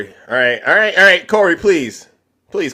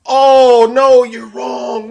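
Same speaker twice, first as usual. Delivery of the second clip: slow, loud high hammed-up denial; mock shout; 10 semitones higher, 6 dB louder, much slower than usual. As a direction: drag out the protest in fake outrage. Mock argument with muted guest.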